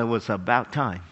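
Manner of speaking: low, soft cat grumble; unimpressed cat. Retold or imitated. Cat greeting owner coming home, low and unimpressed. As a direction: greet the owner with quiet disdain. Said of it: "when my cat comes out".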